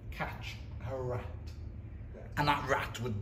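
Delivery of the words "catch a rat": hushed echo. Acted. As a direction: drop it to a hush. Echoing "catch a rat" for effect.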